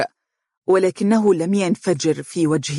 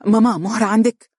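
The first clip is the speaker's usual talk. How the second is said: urgent, louder quick question; hurried; 5 semitones higher, 4 dB louder than usual. A narrator's reading. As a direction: ask fast, worried. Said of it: "قال بسرعة".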